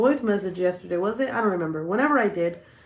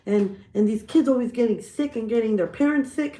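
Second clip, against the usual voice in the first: higher and louder, a dad going off; hammed-up fatherly ranting; mock rant; 5 semitones higher, 5 dB louder than usual. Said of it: "he went on a rant".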